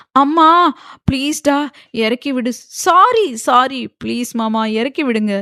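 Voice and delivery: high, loud scared begging; frightened pleading. A narrator's reading. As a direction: beg to be let down. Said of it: "Sanmathi பயத்தில்". (பயத்தில் கெஞ்சல்)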